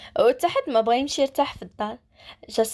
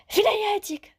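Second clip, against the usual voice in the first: much higher, angry demand at the police station; 10 semitones higher than usual. A narrator's reading. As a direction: storm in demanding where she is. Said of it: "ومشى للكوميساريه معصب". (بعصبية وهو كيسول على المجرمة)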